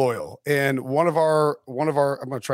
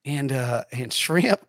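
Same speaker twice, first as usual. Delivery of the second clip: lightly hammed-up Southern accent; fake Southern accent; 2 semitones higher than usual. Coach in game-day interview praising grits, fake Southern accent. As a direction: stammer through a put-on Southern accent. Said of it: "just faking a Southern accent".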